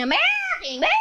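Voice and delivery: high wailing witch; high dying witch wail; melting witch. Acted. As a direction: wail as you melt away.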